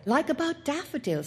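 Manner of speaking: put-on the boy citing his sure tip; boy's voice. A narrator's reading. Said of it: "said the boy".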